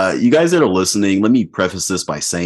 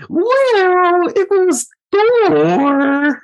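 mock swooning woman; much slower than usual. Quoting women swooning over Thor.

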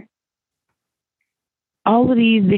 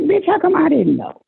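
squeaky, much higher outraged question; high mock indignation; 12 semitones higher than usual. Woman asking friends why nobody told her, high indignant squeal. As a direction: demand answers in a high squeal. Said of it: "I'm like".

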